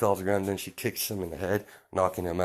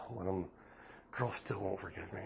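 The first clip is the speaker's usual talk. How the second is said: much softer, deflated mother; hushed, mildly despairing aside; dejected sigh; 11 dB quieter than usual. Acted. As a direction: sigh it quietly to oneself. Rebuffed mother sighs to herself.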